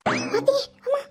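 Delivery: high-pitched startled cry; startled fright. Acted. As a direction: cry out as if suddenly spooked. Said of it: "பயந்துட்டீங்களோ?". (பயந்து அலறும் உயர்ந்த குரல்)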